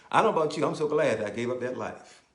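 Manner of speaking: loud, rising preacher's cry; fervent preaching. Acted. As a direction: lift the congregation with it. Preaching at full force.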